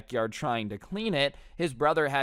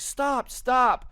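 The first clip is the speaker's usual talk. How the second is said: high-pitched mock mom yell; frantic, pitched-up command; urgent shout; 11 semitones higher, 4 dB louder than usual. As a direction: imitate an alarmed mom calling a halt. Mom shouting to stop the kid.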